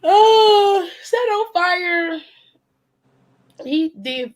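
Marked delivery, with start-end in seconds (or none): 0.0-2.2 shocked. Real, not acted. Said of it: "reacting to fire play".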